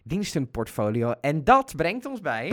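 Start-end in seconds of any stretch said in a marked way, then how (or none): 1.2-2.5 announcer voice. Acted. Announcing the next segment.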